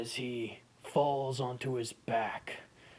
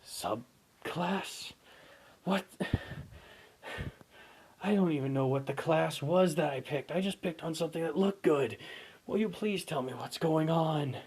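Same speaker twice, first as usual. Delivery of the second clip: pleading hero; 5 semitones higher, 4 dB louder than usual. Acted. Hero begging.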